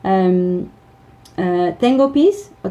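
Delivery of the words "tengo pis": put-on child's voice. Child asking for the toilet.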